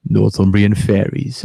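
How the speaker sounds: low, slow, mock-spooky title; Alan Robson spooky voice. Announcing the fairy tale to the stream in a slow spooky voice. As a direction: drag the title out low like a ghost story host. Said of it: "trying to sound scary like Alan Robson does".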